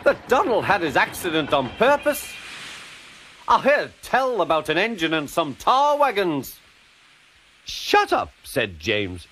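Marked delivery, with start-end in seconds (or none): 0.0-6.6 high, arch needling; pointed teasing. A narrator's reading. Douglas needles James. 7.7-8.3 very high, cross snap; indignant retort. A narrator's reading. James snaps at teasing Douglas.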